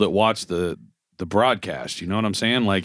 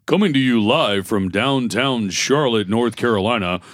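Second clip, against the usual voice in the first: slow, measured anchor delivery; made-up newscaster voice; 3 semitones lower than usual. Retold or imitated. Mocking newscasters he hates, put-on anchor voice. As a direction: stretch it out like a stagey live report. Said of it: "why are you making up that voice".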